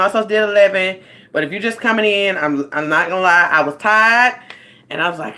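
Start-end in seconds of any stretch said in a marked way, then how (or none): none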